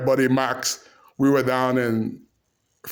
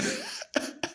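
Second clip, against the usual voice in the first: high squeaky laugh; 12 dB quieter than usual. Real, not acted. Cracking up after the retold pep talk.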